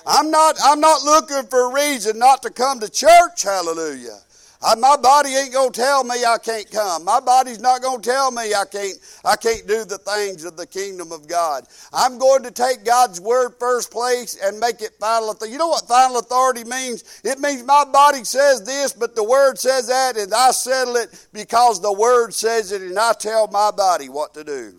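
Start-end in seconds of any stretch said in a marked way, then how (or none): 0.0-6.4 loud fervent preaching. Real, not acted. Preacher rousing the congregation, loud and fervent. 11.9-24.7 rising fervent sermon delivery; preaching. Real, not acted. Preacher stressing God's word first, fervent.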